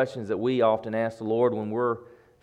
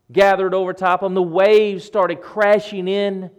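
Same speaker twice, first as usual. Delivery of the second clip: loud, raised storm storytelling; full-force preaching; 10 semitones higher, 11 dB louder than usual. Acted. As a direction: preach the mounting storm with urgency. Preacher dramatizes the storm rising.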